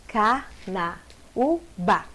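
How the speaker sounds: very slow Carnaúba, car-na-ú-ba with gaps; syllable by syllable. Shown as a demonstration. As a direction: split Carnaúba into four separate syllables. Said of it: "a mesma coisa".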